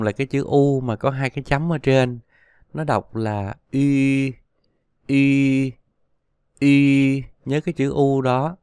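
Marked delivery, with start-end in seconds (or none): 3.7-7.2 long, level Y said as ü with rounded lips. Shown as a demonstration. Teacher models U with two dots, Y held with rounded lips. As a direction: hold Y steadily through rounded lips, like ü. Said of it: "Bắt buộc phải tròn môi".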